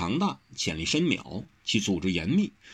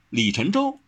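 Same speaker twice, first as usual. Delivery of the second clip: forthright reply, a touch higher; brisk answer; 4 semitones higher than usual. A narrator's reading. Wen Rou names the gang leader, brisk and forthright. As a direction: answer readily and frankly. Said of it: "温柔答得更爽快".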